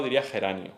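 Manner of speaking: "geranio" said casually, the ending merged; geranio, relaxed and soft; relaxed diphthong. Shown as a demonstration. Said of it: "si estás todo relajado".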